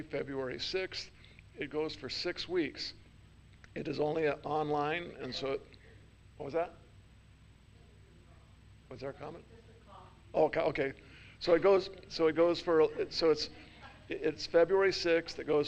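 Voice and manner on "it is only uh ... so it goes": ordinary talk, nothing marked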